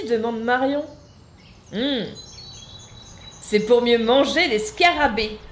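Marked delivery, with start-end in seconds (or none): 1.7-5.4 sly. Acted. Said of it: "d'un air malin".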